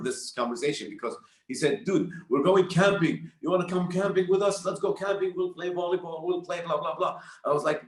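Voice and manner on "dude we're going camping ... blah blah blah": higher, lightly acted eager friend; eager invitation. Friend Talal inviting him along on a camping trip, eagerly. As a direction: imitate a buddy pitching a plan.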